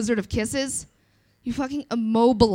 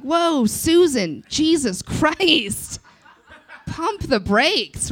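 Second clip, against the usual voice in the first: very slow, higher mock alarm; hammed-up slow protest; stunned; 5 semitones higher, much slower than usual. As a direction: drag it out in disbelief. Reacting to the chipper reporter.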